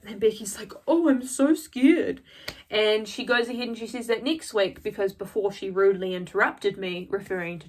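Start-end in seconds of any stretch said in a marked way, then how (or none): 0.9-2.2 slow, high, drawn-out sarcasm; mock fear. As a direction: drag it out in exaggerated fake fright. Mock reply to a threat.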